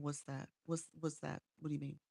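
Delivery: ordinary talk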